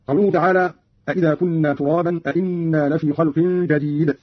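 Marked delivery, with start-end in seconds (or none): none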